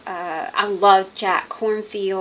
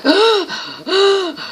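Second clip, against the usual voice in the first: mock dramatic confession; 5 semitones higher, 3 dB louder than usual. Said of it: "she'll go".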